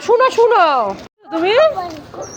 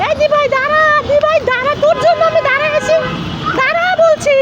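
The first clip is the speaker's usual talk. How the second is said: high, childish mock-cross call to his sister; voicing the little brother; 9 semitones higher than usual. Mother speaking as her son, calling his sister to wait, childish high voice. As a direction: play the sulky small boy telling his sister to wait.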